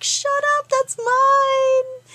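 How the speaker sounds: indignant outburst. Retold or imitated. Narrator claiming her favorite phrase, high shrieking protest. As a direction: squeal it like she stole your phrase. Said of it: "Which made me mad".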